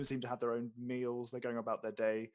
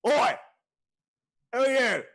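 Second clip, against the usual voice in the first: loud, high shouted challenge; gruff orc innkeeper; 12 semitones higher, 15 dB louder than usual. Acted. Orc barkeep challenges a newcomer, loud and suspicious. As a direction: bellow it across the bar. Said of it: "he squints at you".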